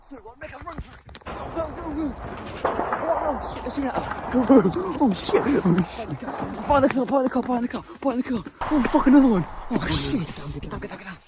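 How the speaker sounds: high, loud panicked yelling under fire; shaky stammering panic. Real, not acted. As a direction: scream for cover in real panic. Under gunfire, running for cover.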